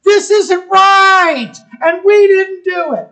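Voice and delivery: high, loud and slow denial; alarmed protest. Retold or imitated. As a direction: plead your innocence loudly, dragging it out. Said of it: "That's what they're saying".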